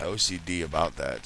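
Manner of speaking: ordinary talk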